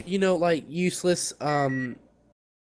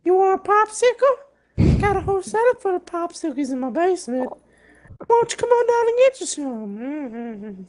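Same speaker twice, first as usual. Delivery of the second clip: put-on creaky old hermit, voice cracking; very old man; 11 semitones higher than usual. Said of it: "the old man voice along with voice cracking".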